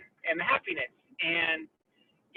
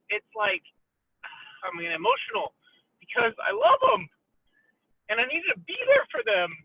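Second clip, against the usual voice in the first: emotional; 12 semitones higher than usual. Real, not acted. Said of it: "letting me be a little emotional".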